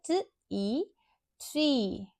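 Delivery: "टी ई, tree" as t, then ee, then the blended word; sounded-out long E. Shown as a demonstration. Teacher blends tree for learners, sounds first then word. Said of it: "long E sound produce करेगा".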